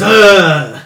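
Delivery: loud guttural ogre grunt. Shown as a demonstration. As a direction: bark it out like an ogre. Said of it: "everything said in the ogre voice is".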